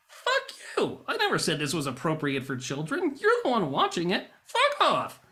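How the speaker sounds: high-pitched, loud mock outburst; angry retort. Vlogger answers a commenter in his head, angry retort. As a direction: blow up at the commenter in mock fury.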